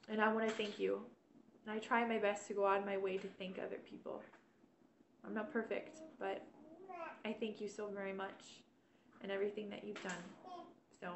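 soft, choked-up thanks with teary eyes; thanking while fighting back tears. Real, not acted. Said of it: "sorry about the teary eyes".

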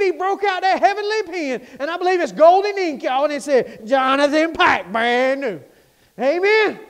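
loud, ringing preacher shout; shouting the good news; preaching at full force. Acted. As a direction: proclaim it to the congregation.

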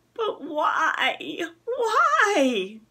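high, loud, whining insistence; insisting loudly. A narrator's reading. Boy insisting to his mother, loud and whiny. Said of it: "Toby insisted loudly".

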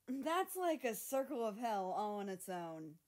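mock horror at the idea; disgusted. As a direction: spit it out in disgust. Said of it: "shuddered violently, making a gagging gesture".

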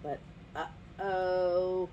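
very slow, drawn-out warning; ominous uh-oh. A narrator's reading. Storyteller hints at Toucan's trouble, slow ominous uh-oh. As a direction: stretch it out with foreboding.

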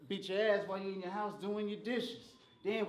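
usual speaking voice

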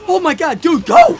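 high frantic yell; panicked yell. Real, not acted. Chased again.

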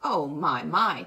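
loud, drawn-up mock dismay; mock bored. A narrator's reading. Dally unimpressed by the threat, mock bored. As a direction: play unimpressed, punching each word loudly. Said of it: "Dally looked bored".

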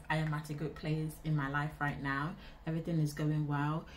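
ordinary talk, nothing marked

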